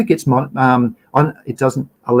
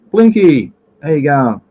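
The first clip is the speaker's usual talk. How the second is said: calling out; 2 semitones higher, 4 dB louder than usual. Real, not acted. Host hails a viewer joining the chat, calling out.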